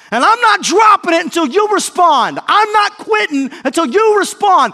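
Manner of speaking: full-force high, insistent plea; desperate shouting. Acted. Blind beggar begging Jesus for healing, shouting desperately. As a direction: cry out relentlessly, refusing to quit.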